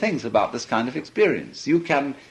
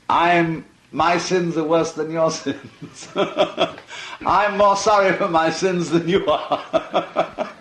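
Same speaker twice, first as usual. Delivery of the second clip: higher, slow, laughing mock penitent; laughing mock humility; 5 semitones higher, much slower than usual. Mocking saints who compete over sins, laughing. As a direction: giggle through a boastful confession of sins.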